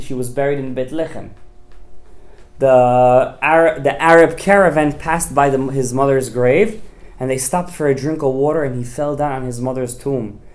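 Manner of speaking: normal talking voice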